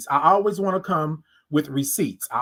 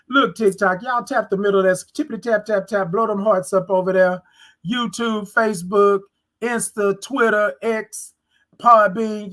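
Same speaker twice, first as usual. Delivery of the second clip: high, upbeat shout-outs to platforms; rally the viewers for likes; livestream hype; 3 semitones higher than usual. Real, not acted. Streamer hyping her audience across platforms, upbeat.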